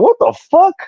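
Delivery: high, shocked outburst; retold disbelief. He retells his shock at being told off, high and incredulous. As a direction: squeak it out in disbelief. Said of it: "I was like".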